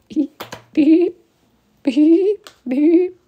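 mouth beeps. Acted. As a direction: beep each one like a bleep over a swear. Mock censor beeps.

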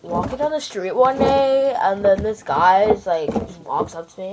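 ordinary talk, nothing marked

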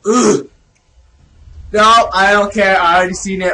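ordinary talk

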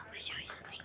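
hushed whisper. Real, not acted. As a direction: keep it to a whisper. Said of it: "I need you to be a little quiet".

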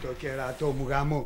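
cursing under breath. Acted. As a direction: grumble it to yourself, dropping low and slow. Cursing aside while kept waiting.

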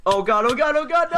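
rising panic, high and loud; panicked yelling. Real, not acted. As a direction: yelp it as you lose the fight. Losing a duel in game.

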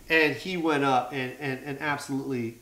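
ordinary talk

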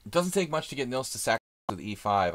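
ordinary talk

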